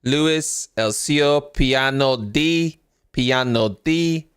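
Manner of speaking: mock Italian accent. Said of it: "That means".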